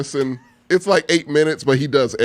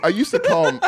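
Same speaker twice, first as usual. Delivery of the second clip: ordinary talk, nothing marked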